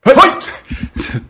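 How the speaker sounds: high, loud arcade announcer shout; booming fighting game announcer. Pretend.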